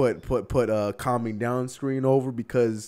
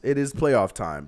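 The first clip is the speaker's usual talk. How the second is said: normal talking voice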